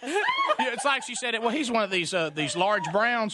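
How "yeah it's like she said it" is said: laughing. Real, not acted.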